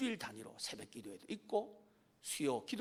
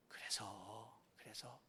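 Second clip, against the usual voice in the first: breathy stage whisper; 11 semitones lower, 16 dB quieter, much slower than usual. Acted. Preacher drops to a whisper before the punchline. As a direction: lean in and whisper it.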